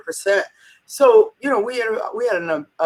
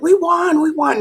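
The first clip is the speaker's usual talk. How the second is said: high, excited retold cheer; retold mock scream; 10 semitones higher than usual. Staff rehearsing a win, cheering high and excited. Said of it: "everybody starts to scream".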